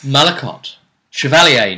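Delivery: ordinary talk, nothing marked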